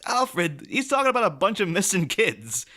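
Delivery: high-pitched, indignant mock telling-off; comic outrage, half laughing; exasperated scolding. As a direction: scold the butler in disbelief, pitch shooting up. Mock scolding Alfred over missing kids.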